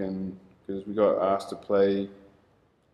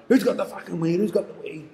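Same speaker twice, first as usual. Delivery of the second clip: mock cop demanding, pitched higher; imitating a cop; 9 semitones higher, faster than usual. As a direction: play the aggressive cop barking questions. Cop shouting at the boys.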